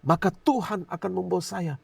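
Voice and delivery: mimicked prophet building in force; imitated prophet. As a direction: declare God's verdict on someone. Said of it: "terus dia bilang lagi".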